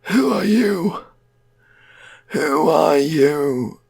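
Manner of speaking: loud, drawn-out eerie wail; high, stretched-out ghoulish call; returned dead man's voice. Acted. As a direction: wail it slowly and high, like the undead. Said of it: "that guy's always gonna talk like that".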